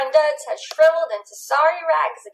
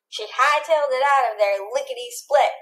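ordinary delivery, nothing marked

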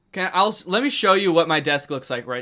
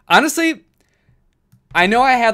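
excited burst; 7 semitones higher, 6 dB louder than usual. Real, not acted. Proud of the summary score.

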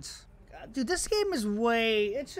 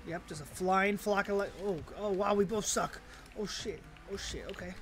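normal talking voice

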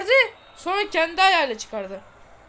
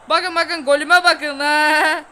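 gloating; 8 dB louder, much slower than usual. Real, not acted. Gloating about his goal.

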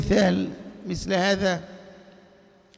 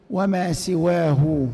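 slow recitation; 4 semitones lower, much slower than usual. Shown as a demonstration. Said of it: "teacher recites Alfiya line slowly".

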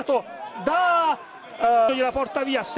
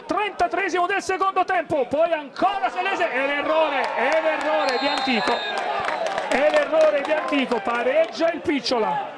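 breathless italian goal commentary; 2 semitones higher than usual. Real, not acted. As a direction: repeat the goal call breathlessly. Equaliser called in live commentary.

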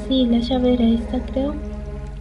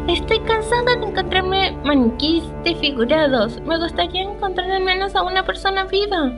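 higher-pitched voicing of a weary character's line; game dialogue read-aloud; 8 semitones higher than usual. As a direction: read the character's complaint in a lifted voice. Reads character line after "Dice.

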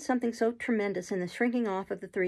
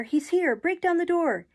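high, loud frantic shout; shrieking; 9 semitones higher, 6 dB louder than usual. A narrator's reading. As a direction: shriek to summon the crowd outside. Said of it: "shrieked the boy in a voice that rent the air".

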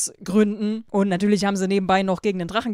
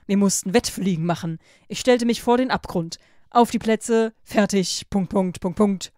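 louder, lively read-aloud narration; story reading; 6 dB louder than usual. A narrator's reading. YouTuber reads a Wattpad story to listeners, lively narration.

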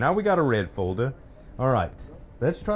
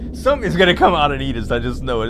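loud, fast, nervous dread; scared; 8 dB louder, faster than usual. Real, not acted. Player fearing something will eat them, loud and fast. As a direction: rush it out in fear.

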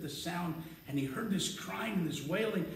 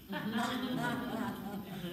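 half laugh, half sob; 5 semitones higher, 4 dB quieter than usual. Acted. Crying devil in the story.

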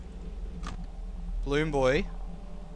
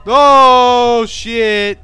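much louder startled oh shit; scared shout; 2 semitones higher, 11 dB louder than usual. Real, not acted. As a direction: yell it in shock. Sudden scare.

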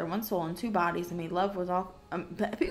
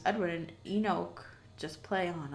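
ordinary delivery

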